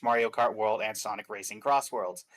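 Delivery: ordinary talk, nothing marked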